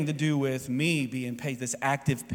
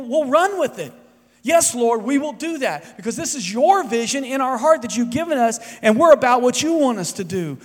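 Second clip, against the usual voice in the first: raised, high, loud preaching voice; full-force preaching; 8 semitones higher, 6 dB louder than usual. Acted. Pastor urging the congregation to be patient, full-force preaching. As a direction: preach it out to the whole room.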